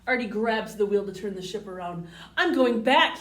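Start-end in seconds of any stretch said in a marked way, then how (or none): 2.4-3.2 loud, insistent cry. A narrator's reading. Artie cries out as he turns the ship, loud and upset. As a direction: blurt it out loudly. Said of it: "he cries".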